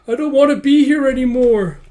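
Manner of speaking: loud, high put-on wail; panicked wail. Lieutenant panics under fire.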